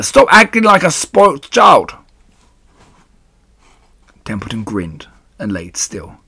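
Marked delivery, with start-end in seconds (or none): 0.0-1.9 high, very loud command; angry scream. A narrator's reading. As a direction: scream at a lazy shirker. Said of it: "screamed Wilbur".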